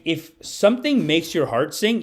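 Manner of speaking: normal talking voice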